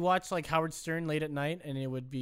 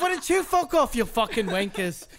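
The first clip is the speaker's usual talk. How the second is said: mock-belligerent muddled Irish; garbled Irish accent; 7 semitones higher, 3 dB louder than usual. Telling co-host to fuck off, hammed-up Irish accent. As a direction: pick a fight in a badly faked Irish accent.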